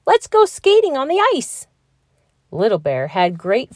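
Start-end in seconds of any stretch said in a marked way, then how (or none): 0.1-1.7 high, loud excited call; shouted. A narrator's reading. Fox calling friends to go skating, loud. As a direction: shout the invitation to skate. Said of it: "shouted Flinty Fox".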